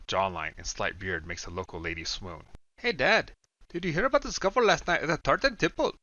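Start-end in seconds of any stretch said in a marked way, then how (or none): 2.8-5.9 put-on young son. A narrator's reading. Son voiced in a story.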